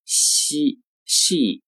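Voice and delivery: "she" with a Mandarin x, like "xi"; "she" as Chinese 西, lips spread from the start; mispronounced as xi. Shown as a demonstration. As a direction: say "she" wrongly with a thin palatal xi sound. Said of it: "常见的错误读法呢是".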